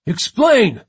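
sharp, loud, high demand; snapped order. A narrator's reading. First mate demanding London's plan, snapping. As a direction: snap it at a subordinate. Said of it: "Katz snapped".

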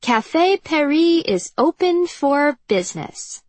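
put-on high, slow, hammy French accent; over-the-top bad French accent, slow and high; terrible French accent. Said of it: "in the terrible French accent".

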